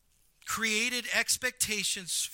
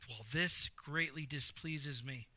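slow, low, understated anger; quietly seething, measured and slow; displeased Jonah; 4 semitones lower, 5 dB quieter, slower than usual. Acted. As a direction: state your displeasure word by word, contained. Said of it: "So Jonah's like".

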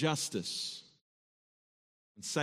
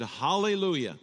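loud, raised hallelujah of relief; exclaiming; 3 semitones higher, 4 dB louder than usual. Retold or imitated. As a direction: call it out like good news just arrived. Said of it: "you exclaimed".

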